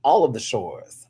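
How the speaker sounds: usual speaking voice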